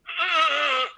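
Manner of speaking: high-pitched drawn-out groan of a winded fighter; imitated pained grunt. Boxer recalls opponent hit in the ribs, imitated groan. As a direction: imitate him groaning from a rib punch. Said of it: "I heard him go".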